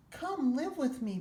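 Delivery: put-on boy's voice. A narrator's reading. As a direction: coax it like a child to a pet. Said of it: "Brian said".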